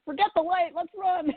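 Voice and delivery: high-pitched pretend alarm; jokey high squeal of urgency; mock panic. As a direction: play at fleeing in a high voice. Jokes about fleeing a ghost hunt.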